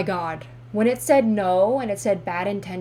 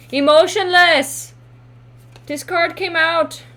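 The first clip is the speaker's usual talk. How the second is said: loud, high outburst; shocked exclamation; 8 semitones higher, 10 dB louder than usual. Real, not acted. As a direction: announce the card in loud disbelief. Exclaims at a card turned up.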